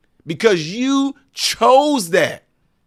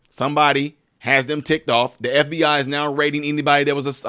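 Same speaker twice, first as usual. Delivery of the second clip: normal talking voice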